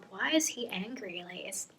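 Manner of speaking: hushed whisper, thinking to oneself; barely voiced, wondering aloud; inner thought. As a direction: whisper it like a private worried question. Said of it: "Alina thinks to herself".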